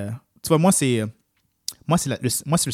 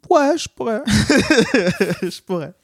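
high silly voice; 8 semitones higher, 5 dB louder, much slower than usual. Acted. Mocking dragon tattoo idea.

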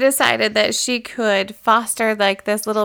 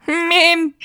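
mock shout Mim; kids calling out; 8 semitones higher than usual. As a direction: call across the store for mom. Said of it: "around the whole store trying to find her".

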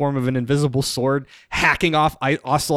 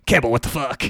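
mock gruff Snake. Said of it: "Snake's like".

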